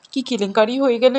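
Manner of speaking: ordinary talk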